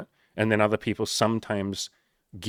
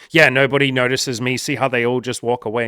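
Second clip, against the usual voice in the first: louder whiny complaint; loud self-pity; 3 semitones higher, 7 dB louder than usual. Pretend. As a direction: moan it to prove a point. Hurt ego complains loudly.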